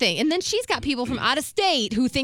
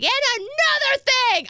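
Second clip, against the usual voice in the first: high, loud mock tirade; re-enacted screaming rant; retold screaming; 6 dB louder than usual. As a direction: pile on the next grievance at a yell. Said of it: "even when I'm screaming".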